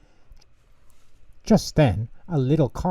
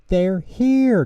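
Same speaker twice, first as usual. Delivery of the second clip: high, louder excited burst; excited gasp; 9 semitones higher, 7 dB louder than usual. A narrator's reading. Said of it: "Tutter gasped".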